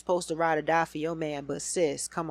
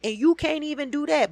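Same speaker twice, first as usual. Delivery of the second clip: high, loud incredulous repeat; yelling the custody math at Kirk; exasperated repeat; 7 semitones higher, 4 dB louder than usual. Real, not acted. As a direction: hammer the math home. Repeating the complaint, louder.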